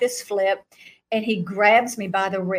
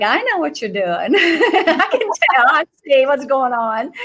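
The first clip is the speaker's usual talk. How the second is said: high, louder teasing laugh; knowing laugh; 4 semitones higher, 5 dB louder than usual. Retold or imitated. Telling Jesus she sees his act, laughing knowingly. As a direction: laugh that you see through him. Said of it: "And I'm like".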